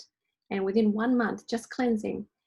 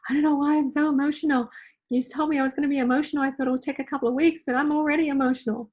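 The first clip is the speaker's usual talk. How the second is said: fast, high and louder, all in a rush; flustered friend; 5 semitones higher, 5 dB louder, much faster than usual. Retold or imitated. Friend calling the coach a few days into the cleanse, fast high flustered rush. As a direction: gush it out like an overwhelmed friend. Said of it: "I had a friend call me and say".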